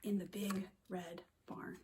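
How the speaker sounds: soft bedtime whisper. Acted. Teacher whispering the sleepy line. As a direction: whisper as they fall asleep.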